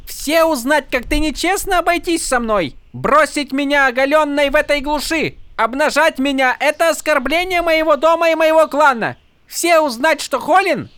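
high, building tirade; mock Horneater accent. A narrator's reading. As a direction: threaten scandal grandly. Fake noble's tirade.

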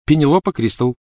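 high, scared but earnest; frightened but sincere. Acted. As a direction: answer frightened yet convincing. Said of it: "звучал испуганно, но очень искренне".

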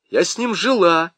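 a touch of defiance, pitch up; mildly cheeky retort to the officer; defiant. A narrator's reading. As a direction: toss it back boldly. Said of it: "дерзко сказала Нюра".

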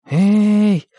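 timid call. A narrator's reading. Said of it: "несмело позвал Виталик".

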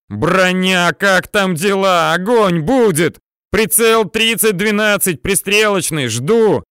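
loud, high, insistent callout; urgent radio call. A narrator's reading. Officer demanding tank fire over intercom, urgent loud call.

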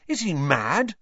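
high, incredulous question; gasped. A narrator's reading. Ron doubting Dumbledore's judgement, gasping. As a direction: blurt it out, appalled. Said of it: "Ron gasped".

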